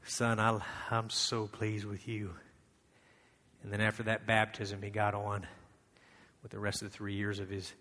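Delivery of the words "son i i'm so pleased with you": much softer and lower, slow and gentle; tender father. Acted. God the Father to Jesus, tenderly and softly. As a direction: reassure a son softly.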